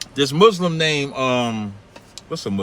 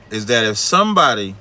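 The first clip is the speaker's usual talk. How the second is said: usual speaking voice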